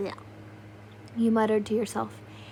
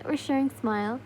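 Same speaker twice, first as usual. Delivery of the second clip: ordinary delivery, nothing marked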